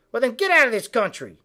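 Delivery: ordinary delivery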